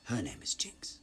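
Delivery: restrained, whispery villain voice; Silco. Acted. Villain correcting the quote, restrained whisper. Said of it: "A quote from Silco".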